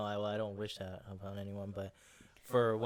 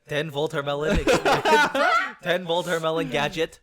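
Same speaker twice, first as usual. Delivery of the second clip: put-on loud Russian accent, higher; Crazy Russian Hacker impression; 6 semitones higher, 15 dB louder than usual. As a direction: announce it like a Russian YouTuber. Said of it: "I love Crazy Russian Hacker".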